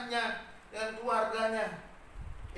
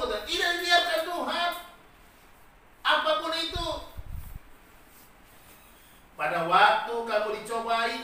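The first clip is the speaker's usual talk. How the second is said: preaching at full force; 3 semitones higher, 5 dB louder than usual. Acted. Preacher proclaiming the verse to the congregation, full force. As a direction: proclaim God's promise like a revival preacher.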